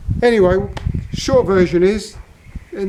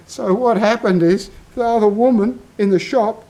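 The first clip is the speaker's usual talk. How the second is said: normal talking voice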